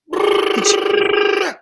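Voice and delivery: loud rolled brrr like gunfire; machine gun brrr. Shown as a demonstration. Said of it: "I love saying things three times".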